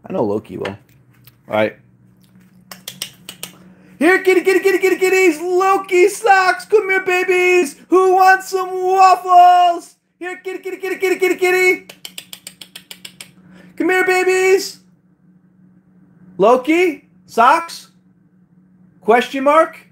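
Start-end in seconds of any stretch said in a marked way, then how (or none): 4.0-14.8 high falsetto pet call; sing-song, high-pitched cat summons. Real, not acted. Calling the cats to come. 16.4-19.8 high, rising pet call; drawn-out, higher-pitched searching call; questioning cat call. Real, not acted. Calling cats by name, questioning.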